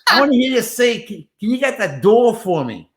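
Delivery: bright, high director voice. Retold or imitated. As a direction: voice the director, upbeat and keen. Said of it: "He goes".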